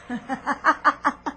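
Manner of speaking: villainous laugh after the curse; sarcastic cackle. Acted. Villainess cackles over the condemned doctor. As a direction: cackle in triumph. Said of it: "rindo com sarcasmo".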